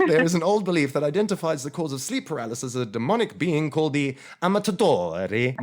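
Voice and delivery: showy mock-Italian delivery; obnoxious Italian accent. Shown as a demonstration. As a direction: ham up the Italian on Amatadori. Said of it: "I'm gonna say it with that obnoxious Italian accent".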